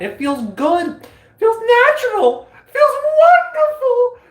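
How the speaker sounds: squealing with bliss; ecstatic. Real, not acted. Ecstatic about metaphysics talk.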